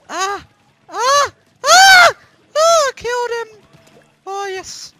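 very high loud screams into a cheer; triumphant screams. Real, not acted. Gamer finally wins the duel, triumphant screaming. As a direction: scream then celebrate the kill. Winning a fight in game.

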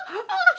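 very high retold shriek; mock scream. Retelling the housemate screaming at the widow's news, mock scream. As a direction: imitate her screaming, pitched right up. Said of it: "she's screaming".

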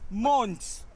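outraged. Acted. Shocked at the news.

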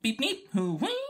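chirpy robot refusal; droid beeps. Acted. R2 refusing to replay the message, stubborn beeps. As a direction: beep a stubborn no.

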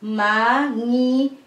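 "Manginginig" broken into ma, ngi, nginig; careful teaching model of "Manginginig", syllables held long. Shown as a demonstration. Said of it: "when you go by syllable".

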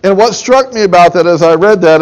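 usual speaking voice

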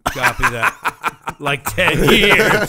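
slow laughing repeat of like ten years; laughing echo. Real, not acted. Host laughing at her short time of ten years, slow echo.

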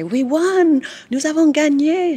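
high, loud cheer of victory; retold jubilant shout; excited shout. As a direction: crow about the win to a coworker. Cheering a football win at work.

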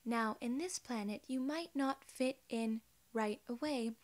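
ordinary talk

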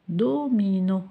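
usual speaking voice

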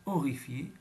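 usual speaking voice

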